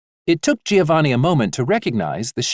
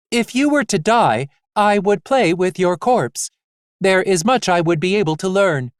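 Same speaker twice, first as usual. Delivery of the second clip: flat mechanical nurse robot; 6 semitones higher, 3 dB louder than usual. Acted. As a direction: deliver it flat like a machine. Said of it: "In a flat, mechanical voice".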